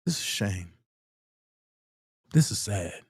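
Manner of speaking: hint of a glum official; quoting the officer. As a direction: drop low and sorry. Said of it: "I said".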